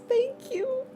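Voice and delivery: very high, squeaky thank you; high-pitched flustered thanks; squeaky touched thank you. Real, not acted. Touched by a sweet chat message.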